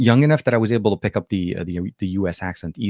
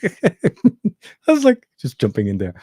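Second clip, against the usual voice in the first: laughing; 8 semitones higher, 4 dB louder, much slower than usual. Real, not acted. Laughing reading chat.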